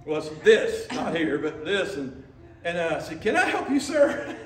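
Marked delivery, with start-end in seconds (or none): 3.2-4.3 much higher, laughing polite question; a tight, anxious courtesy; nervous politeness. Retold or imitated. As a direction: brace for a scolding with a nervous laugh. Nervous polite question to old man.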